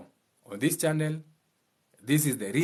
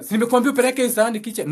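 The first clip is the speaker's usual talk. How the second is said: higher, louder, fast nagging; scolding parent; 8 semitones higher, 4 dB louder, much faster than usual. Retold or imitated. Parent scolding child to take the plate, angry.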